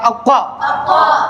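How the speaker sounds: अक्का thick and rounded, stretched out slowly; मोटी आवाज. Shown as a demonstration. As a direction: draw out a full-mouthed heavy अक्का. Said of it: "पुर करके पढ़ना है". (अक्का को मोटा करके, खींचकर)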